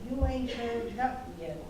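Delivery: usual speaking voice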